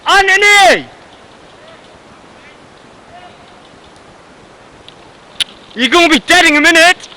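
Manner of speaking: high, loud yell; shouting a warning. Real, not acted. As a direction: call out across the field. Yells warning to teammate.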